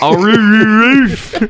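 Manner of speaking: high imitated bark trailing into "roof"; Scottish-accented dog bark. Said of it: "with a Scottish accent still".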